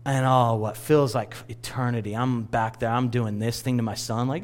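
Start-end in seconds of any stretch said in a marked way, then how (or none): none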